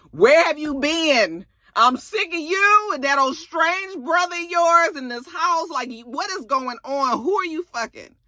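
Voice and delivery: high-pitched, heated confrontation; acted-out jealous tirade; fed-up girlfriend. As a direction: demand answers in a high, exasperated voice.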